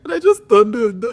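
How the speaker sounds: loud, high-pitched burst through laughter; laughing. Real, not acted. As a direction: break up laughing mid-sentence. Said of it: "I'm even crying again".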